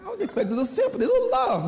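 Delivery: high, fast laughing complaint; mock self-pity, laughing. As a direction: laugh through the hurt. Retelling childhood hurt, laughing.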